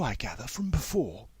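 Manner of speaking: whispering. Acted. Teacher asks softly.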